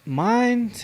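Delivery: ordinary talk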